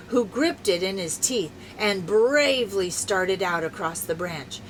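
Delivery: usual speaking voice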